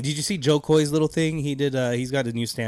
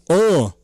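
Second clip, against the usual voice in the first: big breath into a higher, louder grunt; imitated heavy breathing; 5 semitones higher, 7 dB louder than usual. Imitating a rapper on the mic, heavy breathy grunt. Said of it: "He could breathe heavy into the mic".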